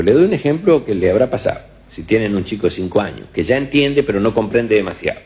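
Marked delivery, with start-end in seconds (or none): none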